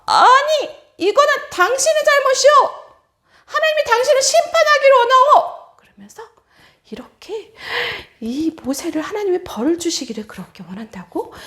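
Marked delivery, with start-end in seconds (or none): 0.0-5.8 high, loud accusing cry; angry crowd. Acted. Israelites angrily blaming Moses, loud and high. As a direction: hurl the blame at him. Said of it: "모세에게 막 화를 내는 것이었어요".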